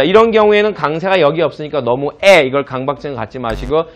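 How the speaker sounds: usual speaking voice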